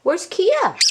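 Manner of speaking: usual speaking voice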